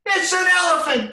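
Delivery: very high loud peep; peeping little chicken. Acted. Reading aloud, the smallest chicken peeps a wrong guess. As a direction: squeak it like a tiny chick. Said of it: "peeped the smallest chicken".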